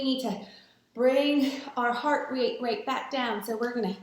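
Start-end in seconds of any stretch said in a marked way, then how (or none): none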